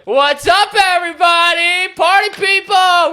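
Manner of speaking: hammed-up party guy yell; mock party shout. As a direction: bellow it like a party host bursting in. Friend barging in at midnight.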